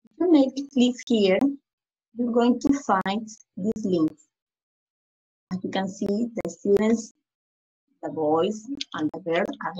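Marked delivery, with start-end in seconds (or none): none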